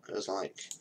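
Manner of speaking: usual speaking voice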